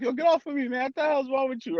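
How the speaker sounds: high, mock-offended brush-off; annoyed friend. Friend pushed off a long hug, mock-annoyed. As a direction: shove him off in a high put-on voice.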